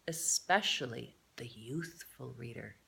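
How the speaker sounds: soft unvoiced aside; whispered aside. A narrator's reading. Narrator addressing young readers, whispering.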